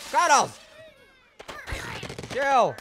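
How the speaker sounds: high, loud sharp call; real shout at Cuddles; exasperated shout. Real, not acted. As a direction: snap at the pet. Shouting at pet then game.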